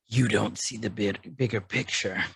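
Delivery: low, soft character voice; Crosshair. Acted. Recapper as Crosshair answering Hunter, low imitation. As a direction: drop the voice low for a cool retort.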